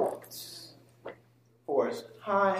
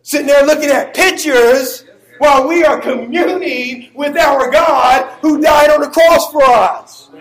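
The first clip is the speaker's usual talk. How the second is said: loud, high, impassioned pulpit shout; full-force preaching; 9 semitones higher, 12 dB louder than usual. Real, not acted. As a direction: preach it at the top of your voice.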